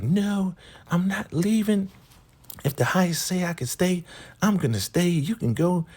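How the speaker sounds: high-voiced refusal, digging in; stubborn high Laura. Laura refusing to leave the compound, high stubborn voice. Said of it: "She's like".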